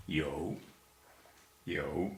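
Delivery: "Yo" low, dipping then rising; low dip-and-rise on each "yo"; third tone. Shown as a demonstration. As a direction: say "yo" in Mandarin third tone, dropping low before lifting. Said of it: "Yo is third tone".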